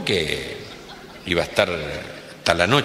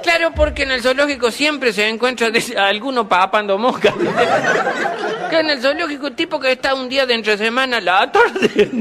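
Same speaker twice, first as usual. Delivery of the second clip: words bubbling with laughter, pitched higher; laughing; 4 dB louder than usual. Real, not acted. Comedian cracking up at his own joke, laughing. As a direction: laugh through the setup of the joke.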